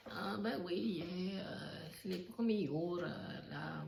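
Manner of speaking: high, put-on Syrian accent, clumsy on purpose; a bad impression of a Syrian accent; lightly hammed Syrian accent telling of war; mock Syrian accent. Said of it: "je ne sais pas faire l'accent, euh, syrien".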